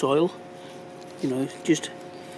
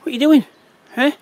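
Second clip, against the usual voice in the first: much higher and louder, half-laughing reproach; a pitched-up what-are-you-doing at a naughty dog; scolding the dog; 10 semitones higher, 7 dB louder, faster than usual. Real, not acted. Owner catches his dog sniffing poo, high and loud.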